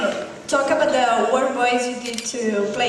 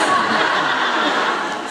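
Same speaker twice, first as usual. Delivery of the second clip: breathy nervous giggle; nervous laugh; 3 semitones higher, 5 dB louder than usual. Real, not acted. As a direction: laugh off the nerves. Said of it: "I'm so nervous".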